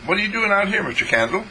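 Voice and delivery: put-on high, childlike boy's question; little boy voice. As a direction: play young John, high and curious. Boy John speaks in story.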